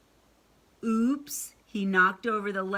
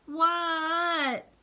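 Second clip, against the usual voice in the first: calling back; 9 semitones higher, 4 dB louder than usual. A narrator's reading. Froggy answers father's call.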